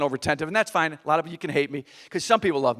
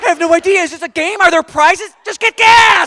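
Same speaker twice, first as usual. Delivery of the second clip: much higher and louder, frantic; panicked shouting; 11 semitones higher, 10 dB louder than usual. Retold or imitated. Husband begging his wife to get gas, panicked yelling. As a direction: yell it in mounting panic.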